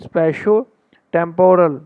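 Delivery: spatio-temporal said very slowly in two halves; broken into parts. Shown as a demonstration. Teacher shows students how to break the word. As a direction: split spatio-temporal, slow and deliberate. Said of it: "ये देखो".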